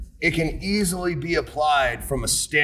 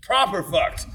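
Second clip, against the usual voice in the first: a clipped British English "Proper fucked", "proper" stressed and falling; mock British accent; 4 semitones higher, slower than usual. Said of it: "a proper King's English definition".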